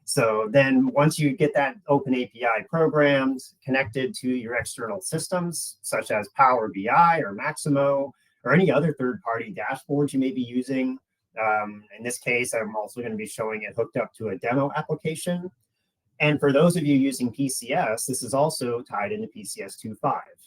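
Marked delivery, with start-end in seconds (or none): none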